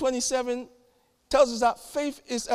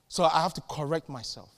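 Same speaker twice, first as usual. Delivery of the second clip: ordinary delivery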